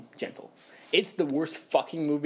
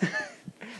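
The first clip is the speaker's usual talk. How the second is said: high laugh burst; 6 dB quieter than usual. Real, not acted. Laugh burst after joke.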